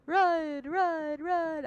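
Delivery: loud, urgent repeated run; urgent shouting. Acted. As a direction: shout it to get everyone moving. Urging the group to flee.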